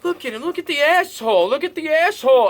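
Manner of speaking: mock him like a bully with a rhythm; impression of dad. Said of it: "To do my best impression".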